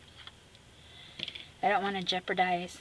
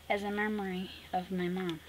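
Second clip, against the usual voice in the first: ordinary talk, nothing marked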